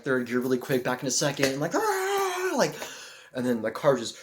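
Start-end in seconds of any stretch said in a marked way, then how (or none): 1.7-2.6 high yelp of alarm; panicked yelp braking; alarmed yell. Retold or imitated. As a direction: cry out in panic. Startled braking.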